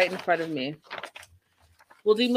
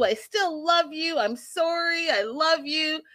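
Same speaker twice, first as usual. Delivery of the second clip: mock pleading; 7 semitones higher than usual. Host imitating Georgie begging Darcy outside, mock pleading. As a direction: plead it hammed-up, pitch raised. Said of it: "He's like".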